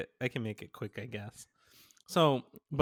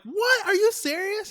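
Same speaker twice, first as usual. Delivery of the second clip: high-pitched incredulous squeal; astonished disbelief; 5 dB louder than usual. Retold or imitated. Teller replays being offered a free guitar, astonished. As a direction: shoot way up high, acting stunned.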